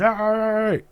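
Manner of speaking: high, loud wordless cries; imitated deaf man's vocalizing. Deaf man trying to move her away, imitated loud vocalizing. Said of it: "That's how he sounded".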